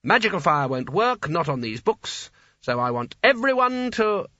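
high, officious instructor; satisfied. A narrator's reading. Sconner checks the wizards have their matches, satisfied and bossy. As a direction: address the group with pleased, emphatic authority. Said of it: "said Sconner with some satisfaction".

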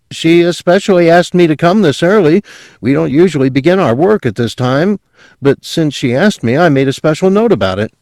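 high fast eager salesman pitch; bright eager young salesman, fast and high. Acted. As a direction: rattle off the sales opener. Salesman insists.

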